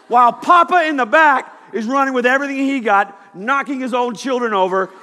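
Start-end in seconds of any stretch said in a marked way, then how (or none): none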